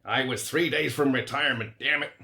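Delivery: mock American movie cop, hammed-up; a deliberately bad American accent, for a joke. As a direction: Rich joking in a cheesy American accent. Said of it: "said in a bad American accent".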